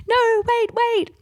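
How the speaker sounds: high, loud panicked no wait; frantic high cry to stop her. Retold or imitated. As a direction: squeal it out in a panic. Stopping her from entering bedroom.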